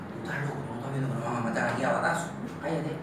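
low, soft, quick scolding; fearful undertone, rushed and low; urgent hushing. Acted. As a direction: shut him up without being heard. Man hushes boy in closet.

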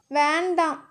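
soft, understated refusal; pleading. A narrator's reading. Woman stops his kiss, softly pleading. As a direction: plead softly with him to stop. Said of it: "கெஞ்சும் விழிகளையே".

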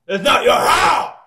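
high-pitched angry yell; angry shout. Real, not acted. Player claiming their house from an intruder, angry shout. Quarrel over whose house it is.

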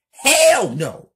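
very high, very loud refusing scream; yelling. Acted. Kelly refusing to bury a body, screamed. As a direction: scream the refusal at full force. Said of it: "Kelly yells".

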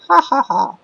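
very high cartoon cackle; a squeaky mock laugh; silly high laugh. Gamer cackling at his own joke in high voice.

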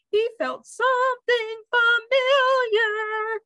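high, slow, suspenseful sing-song; bird reading aloud. A narrator's reading. Bird reads the cliffhanger to Rusty, high and slow. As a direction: stretch each word high as a singing bird. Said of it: "the bird read".

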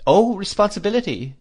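put-on high, fluttery elderly mother; fussy old lady. A narrator's reading. Old lady voiced in story.

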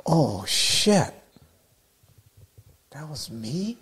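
stunned, the question fading away; shocked realization. Retold or imitated. Surfer seeing himself on film, stunned and fading. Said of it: "so embarrassed and shocked".

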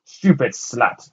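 slow, loud insult shouted upward; angry shout. A narrator's reading. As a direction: spit the insult up at her. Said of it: "the man shouted up".

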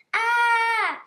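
loud high shriek of shock. Acted. Poisoned leopard collapsing on the table, loud high shriek. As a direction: shriek as your body gives out. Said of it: "He shrieked".

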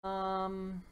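hesitate, then wish out loud; hesitant dinosaur. Acted.

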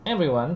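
"Everyone" with its first syllable stressed. Shown as a demonstration. Teacher checking stress with students, everyone stressed on first syllable. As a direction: lean on EV in EVeryone. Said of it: "chạm âm đầu đúng không".

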